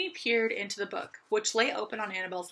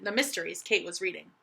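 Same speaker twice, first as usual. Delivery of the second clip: ordinary delivery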